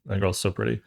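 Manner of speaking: normal talking voice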